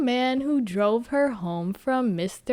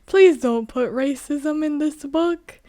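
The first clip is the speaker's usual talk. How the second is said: high, louder crying plea; mock pleading whine; 6 semitones higher, 4 dB louder than usual. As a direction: whine like you're begging. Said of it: "I was like".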